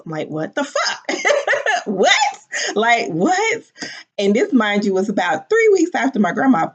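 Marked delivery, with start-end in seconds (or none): 0.3-1.0 shocked. Retold or imitated. Shocked reaction to tree news. 1.1-1.8 a sudden high laugh; real laugh of disbelief; high burst of laughter. Real, not acted. Laugh after shocked reaction. 1.8-3.6 disbelief. Real, not acted. Disbelief at the news.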